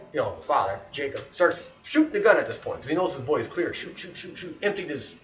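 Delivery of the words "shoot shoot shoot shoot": rapid-fire mouthed shots; "shoot" as a repeated gun noise; imitated gunshots. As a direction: imitate a revolver emptying. Imitates the revolver being emptied.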